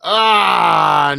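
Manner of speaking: long, loud, falling "aah"; high wail of amusement after laughing; post-laugh cry. Real, not acted. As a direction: let out a loud descending "aah". Delighted sigh after laughing.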